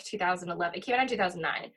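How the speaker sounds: normal talking voice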